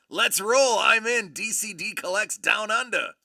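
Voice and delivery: loud, high-pitched rallying cry; excited declaration. Real, not acted. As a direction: announce it with full gusto. Declares himself in for the trip.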